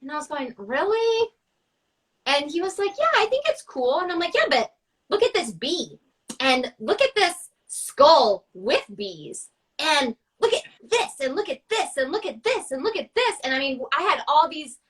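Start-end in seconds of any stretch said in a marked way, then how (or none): 0.6-1.3 high, incredulous rise; surprised disbelief. Retold or imitated. Daughter doubting her dad's praise of the mantis, surprised. Surprised dad likes the painting. 3.0-4.0 high, light voicing of her dad; a casual approving dad. Dad telling his daughter the mantis painting is cool, lightly voiced. 4.3-13.4 eager listing. Retold or imitated. Daughter pushing her other paintings on her dad, building eagerness.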